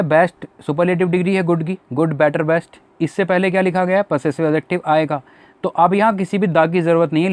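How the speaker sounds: ordinary talk, nothing marked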